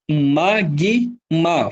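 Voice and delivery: MAGMA broken into ma-gui-ma, each piece drawn out; syllable by syllable. Shown as a demonstration. Teacher checking for digraphs, syllable by syllable. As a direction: say Magma slowly with every letter sounded. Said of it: "Falo todas as letras?".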